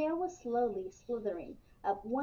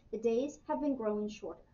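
plain reading voice

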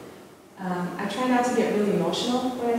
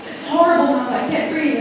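frightened cry; 7 semitones higher, 8 dB louder than usual. Retold or imitated. Frightened daughter at camp quoted.

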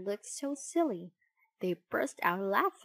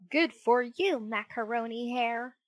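louder teasing call from the hidden voice; a cheeky hidden voice calling a nickname; called out cheekily; 3 semitones higher, 4 dB louder than usual. A narrator's reading. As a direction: impish call. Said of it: "called a voice".

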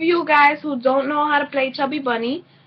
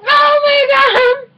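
much higher, loud, slow cry; shout; 12 semitones higher, 7 dB louder, slower than usual. Real, not acted. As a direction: shriek out in disgust. Disgust after spitting marshmallows.

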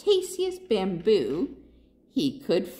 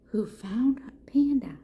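hushed unvoiced question; whisper; 3 semitones higher than usual. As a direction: whisper it like a secret.

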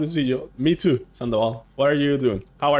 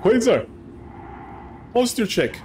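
chat reminder; 11 semitones higher than usual. Pretend. Streamer voices chat telling him to sit up, in a high mock voice. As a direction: play a viewer nagging him. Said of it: "You guys need to tell me".

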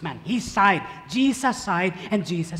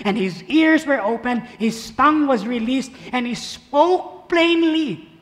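reading together; 4 semitones higher, 5 dB louder than usual. Shown as a demonstration. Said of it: "the cure, together".

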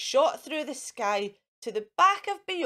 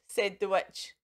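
usual speaking voice

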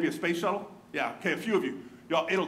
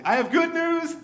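much higher and louder announcement; loud declaration; 9 semitones higher, 6 dB louder than usual. Retold or imitated. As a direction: boom it out like an eager herald. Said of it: "declares loudly".

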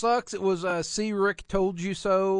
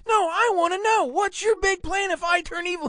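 much higher, louder demanding voice; insistent kid Robin; 11 semitones higher, 4 dB louder than usual. Retold or imitated. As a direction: push like a kid who won't let it go. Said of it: "He goes".

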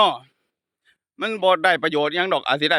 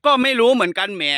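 higher-pitched Khon Kaen Thai-Lao mimicry; Khon Kaen accent; 5 semitones higher, 3 dB louder than usual. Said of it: "มันจะพูดภาษาอย่างนี้เลย". (เลียนสำเนียงขอนแก่นไทยปนลาว เสียงสูง)

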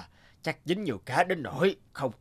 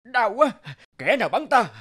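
pained cry; 8 semitones higher than usual. Acted. Giant hit by an arrow.

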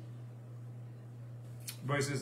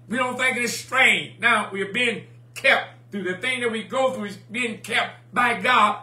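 higher, louder, driving sermon delivery; full-force preaching; 4 semitones higher, 5 dB louder than usual. Real, not acted. Preacher urging the congregation to say a new song, full-force preaching. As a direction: preach it up high and loud.

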